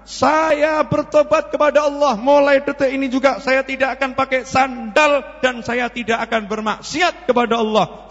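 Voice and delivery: high, declaimed public vow, rising to a loud climax; sudden preacher. Acted. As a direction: proclaim repentance to the crowd like a fired-up orator. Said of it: "Mendadak ceramah". (ikrar tobat lantang ala penceramah)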